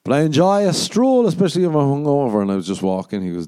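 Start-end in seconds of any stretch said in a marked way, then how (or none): 0.0-2.4 higher, louder airy retort; breezy smug comeback; mock posh reply. Comic answering the homeless man, high breezy retort. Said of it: "And I was like".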